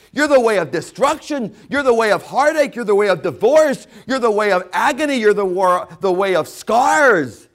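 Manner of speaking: loud, high sermon shouting; fiery preaching. Real, not acted. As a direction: preach it at full force. Preaching at full force.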